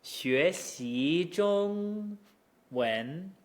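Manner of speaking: 学习中文 drawn out slowly, rise running into the level tone; slow syllables. Shown as a demonstration. Teacher slowly showing the rise run into first tone. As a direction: stretch it out syllable by syllable. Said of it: "then we can just run with it".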